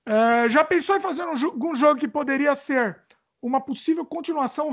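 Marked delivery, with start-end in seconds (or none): none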